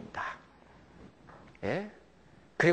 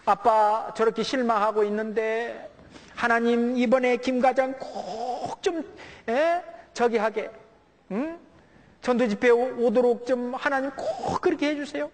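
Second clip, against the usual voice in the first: lightly voiced child, higher and earnest; praying child; 7 semitones higher than usual. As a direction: plead like a small child praying for dad. (아빠를 위해 간절히 기도하는 아이 목소리)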